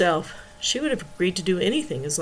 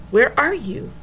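sharp raised demand; 5 semitones higher than usual. A narrator's reading. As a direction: friend pressing hard on the phone. Friend demands answer on phone.

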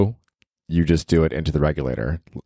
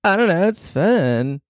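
much higher, playful put-on voice; squeaky voice; 11 semitones higher, slower than usual. Caught talking silly, answering in a high squeaky voice. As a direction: pitch it way up for fun. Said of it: "Why are you talking like that?".

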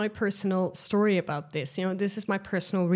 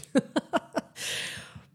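high breathy laugh; 8 semitones higher, 4 dB quieter than usual. Real, not acted. Laughing at her own mistakes.

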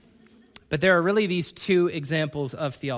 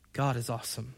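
soft contrast; 6 semitones lower, 10 dB quieter, faster than usual. Real, not acted. Answer after loud line.

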